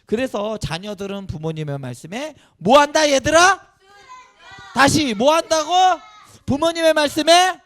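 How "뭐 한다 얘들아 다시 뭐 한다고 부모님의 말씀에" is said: loud, high prompting questions; calling out. Real, not acted. Pastor quizzing children in the congregation, loud high call.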